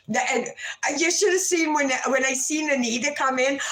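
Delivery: ordinary talk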